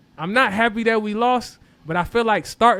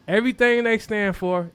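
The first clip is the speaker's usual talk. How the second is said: ordinary talk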